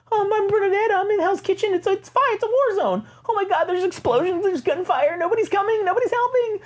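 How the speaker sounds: put-on high falsetto frightened old woman; panicked caller voice. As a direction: play a scared woman calling for help. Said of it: "people talking into their phones".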